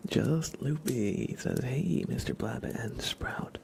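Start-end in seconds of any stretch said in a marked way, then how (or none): none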